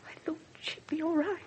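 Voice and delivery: barely voiced, guilty whisper; whispered admission. Mother confesses to the inspector in a whisper. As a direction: let it slip out in a whisper. Mother admits she skipped meeting her daughter.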